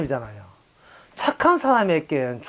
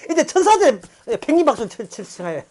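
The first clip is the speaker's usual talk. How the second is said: usual speaking voice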